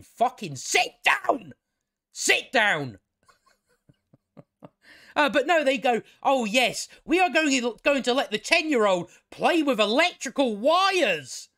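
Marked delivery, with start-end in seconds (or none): 0.0-3.0 higher, slow scolding shout; hammed-up shout at a child; mock yell. 6.2-11.5 high, louder sneering parody; mock their bad decision with exaggerated glee; a hammed-up sarcastic parent voice.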